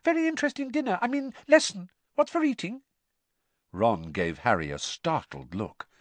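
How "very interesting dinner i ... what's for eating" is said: very high, flustered babble; unnaturally high gabble. Acted. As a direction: gabble on far above normal pitch. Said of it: "gabbled in the same unnaturally high voice".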